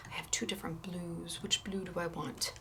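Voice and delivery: soft whisper to herself. Real, not acted. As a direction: murmur it under your breath.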